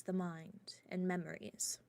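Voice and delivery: hushed, secretive whisper. Real, not acted. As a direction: drop to a whisper. Secretive talk of illegal magic.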